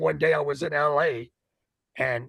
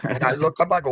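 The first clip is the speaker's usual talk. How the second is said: ordinary talk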